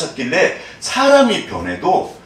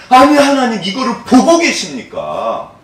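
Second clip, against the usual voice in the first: 화난 항의 흉내; 7 semitones higher, 3 dB louder than usual. Retold or imitated. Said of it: "너무 화가 나니까".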